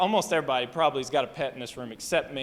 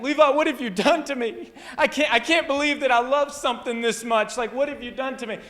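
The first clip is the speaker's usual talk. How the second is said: high, loud, tearful laughing voice; imitated cry-laugh; 7 semitones higher, 5 dB louder than usual. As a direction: act out someone wailing through laughter. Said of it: "it's like a cry laugh".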